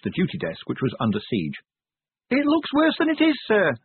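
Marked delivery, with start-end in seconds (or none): 2.3-3.7 very high voice raised over noise; urgent high-pitched report over a crowd; shouting over din. A narrator's reading. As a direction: call out high-pitched to a superior. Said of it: "shouted Cheery over the din".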